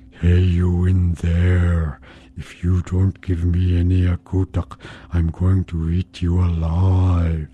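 slow, eerie menacing voice; strange voice. Acted. Monster outside demanding akutak, slow eerie threat. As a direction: threaten her through the door, slowly. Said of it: "that voice said".

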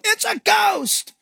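high, slow cry of alarm; mock panicked shout. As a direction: cry out like a frightened disciple. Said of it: "That's why they shouted".

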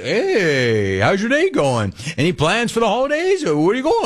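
high, sing-song fake-friendly small talk; hammed-up perky bank clerk; chirpy teller. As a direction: mimic the nosy teller. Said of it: "Oh, but you're there to ask".

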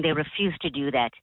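usual speaking voice

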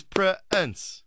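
"Prince" stretched slowly into two separate syllables; two-syllable pronunciation. Retold or imitated. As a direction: break "Prince" in two, like Pr-ince. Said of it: "I got two syllables".

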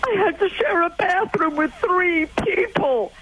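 high, slow mock American-accented teenager. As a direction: imitate an aggrieved American teenage son, drawn out and high. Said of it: "in his American accent".

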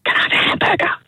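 whispered punchline. Acted. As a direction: hush it like a secret.

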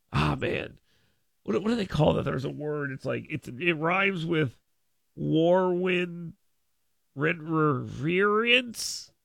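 softly feigning he can't find the word; a quiet, slow, sarcastic groping for the word; mock fumbling for a word. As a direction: pretend to search your memory, slow. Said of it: "foreign interference".

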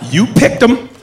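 high-pitched, pointed questioning; accusing look. Retold or imitated. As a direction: mimic skeptical coworkers passing blame.

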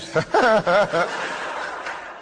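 amused chuckle; easy laugh; real laugh. Real, not acted. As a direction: laugh at the awkward memory. Laughs at own story.

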